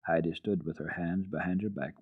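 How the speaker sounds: plain reading voice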